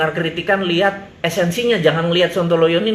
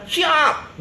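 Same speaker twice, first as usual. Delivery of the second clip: eager yes; 9 semitones higher than usual. Acted. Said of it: "Maka jawaban saya adalah".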